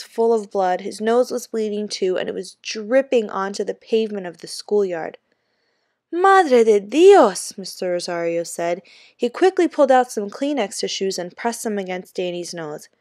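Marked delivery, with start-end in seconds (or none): 6.1-7.6 slow, higher, louder gasp in Spanish; horrified under the breath oath; shocked Spanish. A narrator's reading. Teacher sees the blood.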